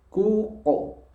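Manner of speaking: "kuko" with the jaw still, thick qaf; qaf sukun drill. Shown as a demonstration. Teacher models the sukun word. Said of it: "Cara bacanya kuko".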